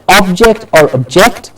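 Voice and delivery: OBject, then obJECT; stress contrast. Shown as a demonstration. Teacher lays out two options, stress shifting on object. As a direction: say object stressed first in front, then at the back. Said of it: "how I am pronouncing each word".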